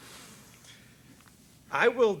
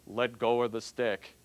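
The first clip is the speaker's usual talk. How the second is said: calm God voice; 6 semitones lower, slower than usual. Acted. God gives the command.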